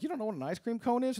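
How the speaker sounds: high, fast disbelieving question; can't believe they never heard of it; stunned disbelief, quick and high; astonished disbelief. Retold or imitated. Astonished at kids.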